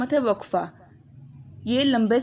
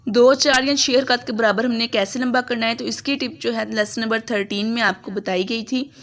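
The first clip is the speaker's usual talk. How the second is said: ordinary delivery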